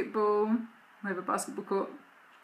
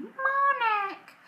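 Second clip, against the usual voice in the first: put-on toddler voice; 3 dB louder than usual. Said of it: "she suddenly started saying".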